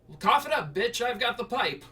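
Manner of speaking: high, loud mock mom call; mom calling from kitchen. Mom shouts back from the kitchen, high loud mock call.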